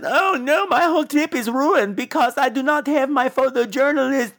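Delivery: high, stilted dubbed-movie voice; put-on high complaining girl; badly dubbed heroine. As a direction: recite it like a bad English dub, every word evenly laid out. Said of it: "why are you talking like that".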